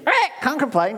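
high squawking parrot echo. Acted. Parrot answering after a terrible day, high squawk. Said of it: "And you hear this".

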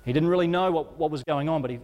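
ordinary delivery

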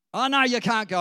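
mock shout, high and very loud; hammed-up ruler taking it all back; sudden refusal. Pharaoh changes his mind, retold.

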